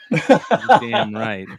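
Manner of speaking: loud, slow, stretched-out catchphrase; slow, drawn-out. Shown as a demonstration. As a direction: drag it out slowly with a laugh. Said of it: "repeated catchphrase, drawn out".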